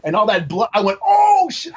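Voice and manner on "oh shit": high, loud outburst; retold yell. Speaker re-enacts their own movie theater reaction, yelled. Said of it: "I, like, yelled".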